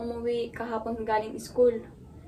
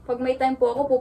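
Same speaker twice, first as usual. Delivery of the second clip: ordinary talk, nothing marked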